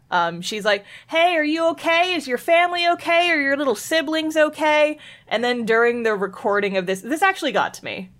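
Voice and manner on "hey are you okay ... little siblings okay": high-pitched voicemail of a concerned friend; Kasumi. Retold or imitated. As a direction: voice a schoolgirl checking in, pitched well up. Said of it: "Kasumi ends up leaving a voicemail".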